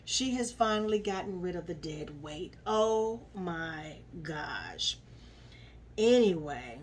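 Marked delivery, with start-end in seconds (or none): none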